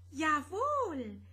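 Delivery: high chirp of a tiny golden creature. Acted. Tiniest Godi welcoming the visitors, high chirp. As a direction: chirp it like the smallest little Godi. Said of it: "zirpte der kleinste und goldigste Godi".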